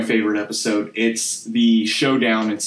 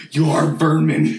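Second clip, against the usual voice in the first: villain voice; slower than usual. Retold or imitated. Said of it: "be like".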